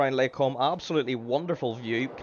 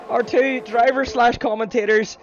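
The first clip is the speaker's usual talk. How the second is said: loud, higher play-by-play; motorsport commentator; 8 semitones higher, 8 dB louder than usual. Acted. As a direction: keep calling the crash. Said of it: "I will do my best to commentate".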